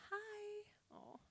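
high, soft, drawn-out hi; tiny high-pitched hello; little greeting. Real, not acted. Greets a small child mid-story.

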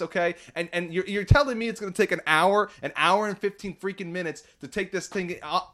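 high, worked-up imagined tirade; frustrated rant. Pretend. Customer imagining blasting the dealership guy over the airbag, frustrated. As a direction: pile up the grievances, voice climbing. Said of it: "I get so frustrated over these things".

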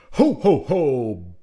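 loud booming Santa laugh. A narrator's reading. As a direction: boom it out, menacing and big. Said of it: "boomed an alarming voice".

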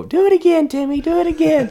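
put-on very high, loud kid voice; high-pitched pestering children; excited begging siblings. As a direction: squeal it like delighted little siblings. Mimicking his begging brother and sister.

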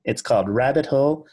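put-on radio presenter, projected. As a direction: introduce a song like a hammed-up radio host. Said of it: "use your best radio voice".

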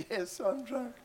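high, giggly voice through laughter; laughing answer. Retold or imitated. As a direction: laugh out the confession to the cop.

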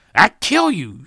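very loud, high yell; shouted threat. A narrator's reading. The Joneses threaten the Smiths, shouting. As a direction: bellow the threat. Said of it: "shouted the Jones".

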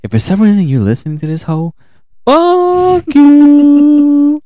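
shouting, pitch jumping high and loud; a loud, high curse flung at someone absent; angry shout. Real, not acted. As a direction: yell it at her like she's listening.

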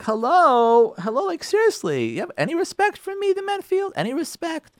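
high, indignant mock wife, pitched well up; offended wife. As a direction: play the insulted wife, lightly hammed. Mock offended wife in front of guests.

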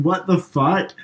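high, loud incredulous yell; retold yell. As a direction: re-enact yelling at the movie in disbelief. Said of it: "I was yelling".

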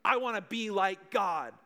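lightly voiced arrogant Satan. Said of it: "Satan's sin was pride".

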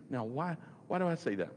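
ordinary talk, nothing marked

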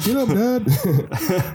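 high dog voice, stretched slow with a rise; drawn-out whiny plea from the pet dog. Pretend. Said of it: "Grace would be, like, licking my face".

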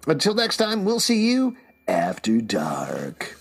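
slow breathy whisper; spooky whisper sign-off. Acted. Podcast sign-off.

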